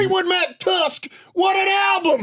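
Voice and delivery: high excited yell about the album; excited shout. Acted. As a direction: shout it, thrilled.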